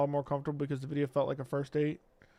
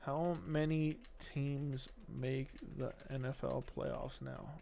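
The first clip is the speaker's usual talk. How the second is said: quiet query; 3 semitones lower, 12 dB quieter, slower than usual. Real, not acted. Asking a voice assistant.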